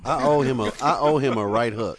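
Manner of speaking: heated outburst. Real, not acted. Vows to punch someone.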